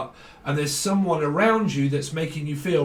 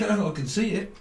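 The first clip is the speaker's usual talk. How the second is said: mock voice; 5 semitones higher than usual. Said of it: "Or, like".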